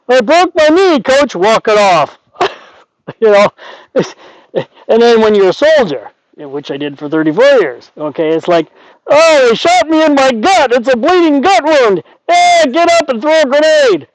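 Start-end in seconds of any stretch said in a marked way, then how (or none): 0.1-2.2 mock tough athlete. Mocking tough athlete sayings. 9.1-14.1 high, mocking soldier voice; mock wounded soldier.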